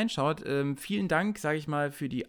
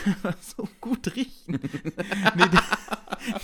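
laughing while talking; words break up in laughter; 6 semitones higher, 4 dB louder, slower than usual. Real, not acted. Laughing at own joke.

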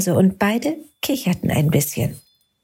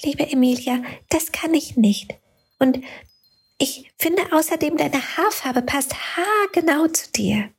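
little light Lumo; 8 semitones higher than usual. A narrator's reading. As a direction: gently say no to the girl. Lumo gently refuses. (höher und sanft)

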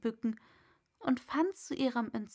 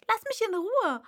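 high, louder indignant plea; 11 semitones higher, 6 dB louder than usual. A narrator's reading. Alice indignantly telling the pigeon off. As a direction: push the pigeon away with your voice. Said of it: "mit Entrüstung".

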